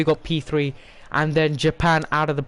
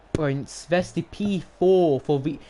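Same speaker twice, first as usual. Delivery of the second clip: ordinary talk, nothing marked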